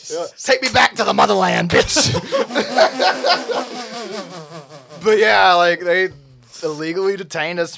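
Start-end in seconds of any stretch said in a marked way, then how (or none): none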